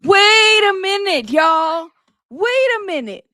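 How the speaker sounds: loud, slow, disbelieving wait a minute; stunned wait a minute. Real, not acted. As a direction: stop everything, you can't believe what you just heard. Reacting to shocking testimony clip.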